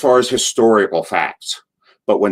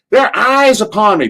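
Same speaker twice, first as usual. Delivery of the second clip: high, loud Southern panic; Cornette impression; 12 semitones higher, 5 dB louder than usual. Cornette mooned during his promo, high panicked Southern voice. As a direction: shriek it like Cornette mid-promo. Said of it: "he goes".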